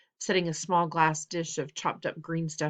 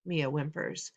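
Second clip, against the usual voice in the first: usual speaking voice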